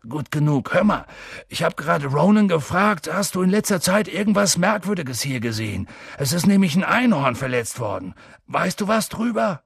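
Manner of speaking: high, urgent questioning; impatient Hagrid. A narrator's reading. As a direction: press the newcomer eagerly for news. Narrator voices Hagrid questioning.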